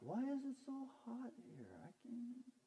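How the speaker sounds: high, soft whine; mock grumbling. Preacher imitating himself grumbling about the heat, small high whine. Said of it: "I grumbled the other day".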